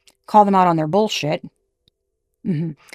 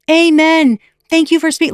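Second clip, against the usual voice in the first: mock fan comments; 9 semitones higher, 7 dB louder than usual. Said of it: "tons of females commenting".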